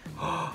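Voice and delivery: mock-horrified gasp; shocked gasp. Purist sees Coke go into whiskey, mock gasp.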